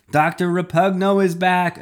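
high, loud alarmed shout; yelled. A narrator's reading. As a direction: yell it out to the crowd. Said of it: "another yelled".

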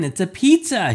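high, loud shouted cry; high kid's shout; child shout. A narrator's reading. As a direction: shout it like an excited little boy. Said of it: "he shouted".

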